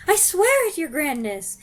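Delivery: high frightened plea; crying witch. Acted. Front-row witch swearing loyalty, frightened. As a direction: swear it desperately. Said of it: "cried the wretched witch".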